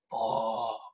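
low, quiet, drained curse; worn-out swearing, softly acted; mock exhausted groan. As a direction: imitate waking up wiped out. Waking exhausted after overdoing it.

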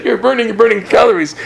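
amused, giggling as she explains; laughing. Real, not acted. Laughing to her co-host about dancing as exercise.

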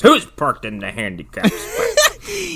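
high, drawn-out mock scoutmaster; scoutmaster impression. Scoutmaster confronting the store about a parking spot, put-on indignant voice. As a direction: challenge the room like an affronted scoutmaster. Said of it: "scoutmaster walks in and goes".

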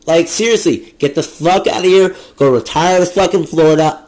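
loud, furious tirade at the boomers; angry rant. Real, not acted. Ranting at boomers to get out, loud furious yell. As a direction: tell them off, yelling.

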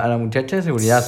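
ordinary delivery

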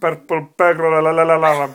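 loud Scottish parody, "alarm" babbled into la-la-la; mock Scottish accent. Friend teasing the host's accent, exaggerated mock Scottish. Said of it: "the extra syllable you put into the last word".

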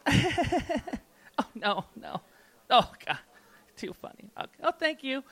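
high giggling, trailing to soft amusement; imitated giggly girlfriend. Woman chatting with liquor store clerk overheard on phone, imitated giggles. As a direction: mimic her tickled, laughing reaction.

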